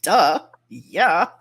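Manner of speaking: loud, mocking duh; father-in-law teasing her for asking. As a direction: make it sound painfully obvious. Father-in-law mocking obvious answer.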